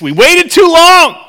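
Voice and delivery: high, loud mock alarm; comic high-pitched dismay; mock panic. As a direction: panic in a hammed-up cry.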